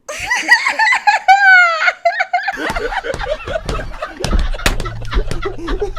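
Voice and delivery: long high laughing shout; long loud laugh. Real, not acted. Host laughing at Giselle's jab, long loud laugh. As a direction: lose it completely.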